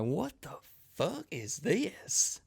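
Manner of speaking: high, drawn-out incredulous question; hammed-up stunned confusion; baffled disbelief. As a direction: stretch it out in high-pitched bewilderment. Acted baffled reaction to request.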